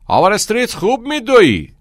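high, loud shouted praise; coach calling out. A narrator's reading. Coach praises a passing runner, shouting. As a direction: holler encouragement across the track.

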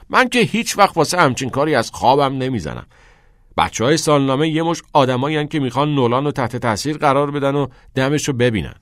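carefree mockery of the yearbook kids; cheerful. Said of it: "میکس با سرخوشی گفت". (با سرخوشی و صدای بلندتر)

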